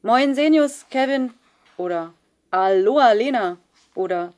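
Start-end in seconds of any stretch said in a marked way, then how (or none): 0.0-1.3 loud jovial greeting across the hallway; calling out. Acted. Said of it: "rief er zurück". 2.5-3.6 loud jovial greeting across the hallway; calling out. Acted. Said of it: "rief er zurück".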